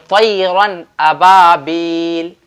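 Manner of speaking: slow तैरन with clear N, drawn-out अबाबील; teacher's measured model of तैरन अबाबील, distinct N; izhar pronunciation. Shown as a demonstration. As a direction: recite तैरन अबाबील slowly, noon without a nasal hum. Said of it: "तो इजहार होगा".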